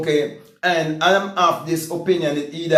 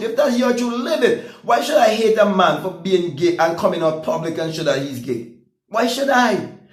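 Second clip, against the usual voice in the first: ordinary talk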